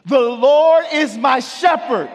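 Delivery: high, slow, shouted declaration; said like you mean it. Shown as a demonstration. Pastor leading the congregation's declaration, shouting it slowly. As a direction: proclaim every word, slow and loud. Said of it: "I need you to say it like you mean it".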